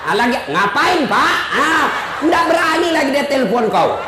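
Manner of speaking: rough brush-off, hammed up; mock tough bark. Mock tough answer, laughing. (bentakan pura-pura sambil tertawa)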